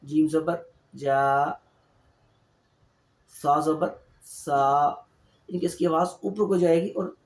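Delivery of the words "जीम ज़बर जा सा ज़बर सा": slow जीम ज़बर जा, सा ज़बर सा with long final vowels; long khada zabar. Shown as a demonstration. Teacher to students, slowly stretching each vowel. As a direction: draw out जा and सा like an extra alif. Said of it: "थोड़ा सा लंबा".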